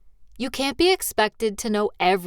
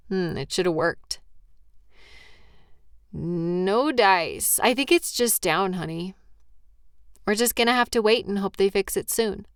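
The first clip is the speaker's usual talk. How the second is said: ordinary talk, nothing marked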